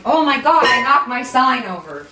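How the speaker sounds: loud, high yelp of dismay; startled exclamation. Real, not acted. Host knocking her sign over, startled. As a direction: burst out in surprise at a mishap.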